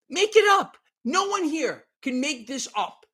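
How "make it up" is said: incredulous. Acted. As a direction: throw it up high, unable to believe it. Repeats phrase, rising in disbelief.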